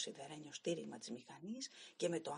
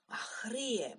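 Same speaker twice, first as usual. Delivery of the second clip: 1 shouted insult; 6 semitones higher, 4 dB louder, slower than usual. Acted. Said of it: "μου φώναξε".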